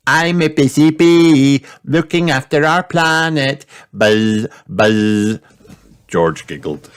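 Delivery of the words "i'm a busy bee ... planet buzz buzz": put-on high, excited little girl buzzing like a bee; a child playing bee, loud and high; playful busy bee. A narrator's reading. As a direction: pretend to be a bee, bouncing along. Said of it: "pretending to be a bee".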